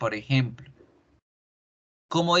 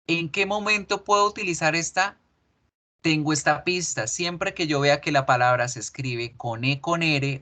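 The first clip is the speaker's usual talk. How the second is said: ordinary talk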